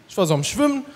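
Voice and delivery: ordinary talk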